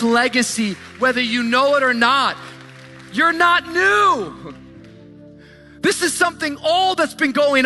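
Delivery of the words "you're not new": ordinary delivery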